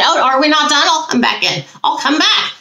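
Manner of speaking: high-pitched mock spirit voice; playfully acted, high voice; imitating a spirit. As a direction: pipe up as the returning visitor. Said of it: "that's what she said".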